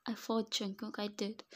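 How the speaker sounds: ordinary delivery, nothing marked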